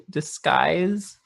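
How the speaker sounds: usual speaking voice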